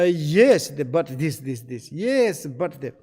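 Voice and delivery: punched yes, then objecting; immediate challenge. Shown as a demonstration. Listener challenging whatever the other one says, immediate challenge. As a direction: jump in with a loud, emphatic yes-but. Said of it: "you immediately is a challenge".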